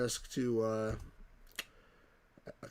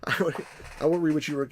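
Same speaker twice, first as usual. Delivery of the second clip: ordinary talk, nothing marked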